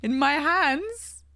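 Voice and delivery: high, thrilled and slow; beaming over the new game; excited. Real, not acted. Holding finished game copy.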